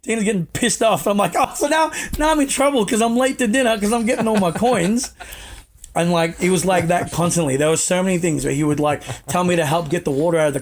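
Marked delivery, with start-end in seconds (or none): 1.4-5.1 mock-offended shout at the boss; indignant shout. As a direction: yell back in disbelief. Indignant retort to Lance's yell.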